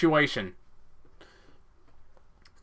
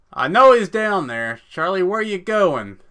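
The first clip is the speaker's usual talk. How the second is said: higher, loud hunter's call; 6 semitones higher, 8 dB louder than usual. Acted. Hunter calling down to the hiding Charlie. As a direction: shout down the gully. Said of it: "You hear the voice call out".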